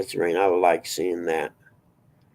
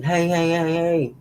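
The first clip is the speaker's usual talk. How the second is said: mock warning yell, pitched up high; imitated yell; 8 semitones higher, slower than usual. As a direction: imitate a first mate calling out in alarm. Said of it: "we hear the first mate yelling".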